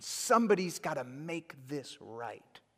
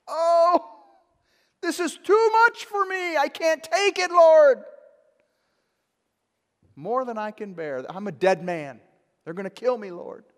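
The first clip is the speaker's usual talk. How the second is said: much higher and louder, a whiny complaint; whining Cain; 11 semitones higher, 14 dB louder than usual. Acted. Cain complaining to the Lord about his punishment, high whining. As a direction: whine it like a self-pitying man. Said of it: "He whines".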